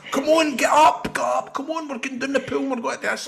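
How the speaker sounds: high, bright-voiced rousing; family calling the hungover man to the pool, high and eager; mimicking the family. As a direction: chirp them up out of bed, high. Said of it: "They're going".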